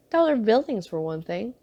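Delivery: usual speaking voice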